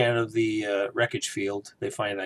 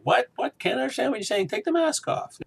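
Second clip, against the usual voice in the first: much higher, whiny complaint; exasperated mock complaint. A viewer complaining to the masked villain, whiny and high. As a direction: squeak out your frustration at the mumbling. Mocking Bane's muffled speech.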